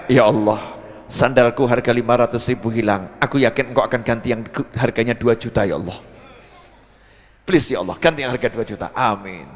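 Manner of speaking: low, earnest mock prayer; pleading prayer. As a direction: act out a humble plea to God. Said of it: "langsung angkat tangan". (berdoa memohon, suara rendah)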